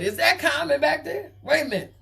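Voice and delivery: mock-shocked caller spotting someone behind her. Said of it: "[name] done said".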